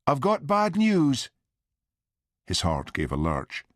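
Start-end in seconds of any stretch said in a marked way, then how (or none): 0.1-1.3 much higher-pitched mother's voice; mother on the phone. A narrator's reading. Mother phoning her son with bad news, high voice.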